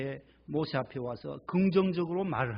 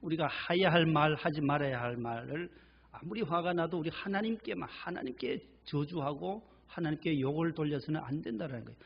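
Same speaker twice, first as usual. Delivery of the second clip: usual speaking voice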